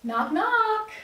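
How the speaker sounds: playful knock knock. Acted. Friend poking her head in, playful and high. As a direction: tease her as you barge in. Friend teasing at office door.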